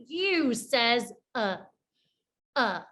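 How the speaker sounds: "uh" with a relaxed jaw; short u sound. Shown as a demonstration. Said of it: "My jaw is relaxed".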